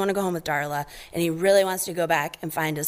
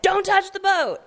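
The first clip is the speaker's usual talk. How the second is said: high, sharp parental warning; warning dad; 13 semitones higher, 4 dB louder than usual. Retold or imitated. Marlin warning Nemo at the boat, high stern dad. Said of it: "And he's like".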